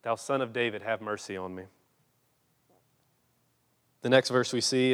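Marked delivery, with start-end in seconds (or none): 0.0-1.6 hushed plea. Retold or imitated. Blind man begging Jesus.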